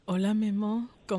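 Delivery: a hint of a sultry hello for Memo; cool voice. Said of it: "una voz perrona así de".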